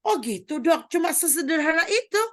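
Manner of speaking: high, disbelieving question; surprised husband. Retold or imitated. Husband amazed at doctor that it's so simple, high. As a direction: raise it up in happy surprise. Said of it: "husband surprised".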